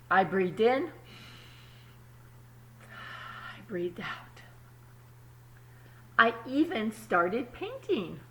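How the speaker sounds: put-on calm storybook reading to kids; story narration. A narrator's reading. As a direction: read it like a picture book at story time. Reads the story aloud.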